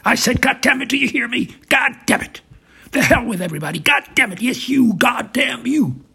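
full-blast, high-pitched rage; explosive, loud cursing in a fit of rage; furious shouting. Acted. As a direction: shout it at the top of your voice. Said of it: "Trump shouting full blast".

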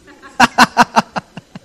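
loud burst of laughter; real laugh. Real, not acted. Preacher laughing at the girl's excuse, loud laugh. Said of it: "Yo me quería reír".

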